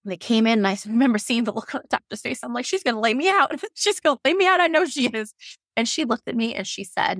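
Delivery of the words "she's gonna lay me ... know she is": high, half-laughing alarm; higher-pitched, amused re-enacted panic; mock dread. Voices her dread of the doctor.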